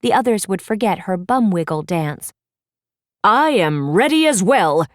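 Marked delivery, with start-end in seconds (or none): 3.2-4.9 a confident young prince, lightly voiced; a touch louder, a princely ready-for-anything tone; lightly played eager prince; Daring Charming.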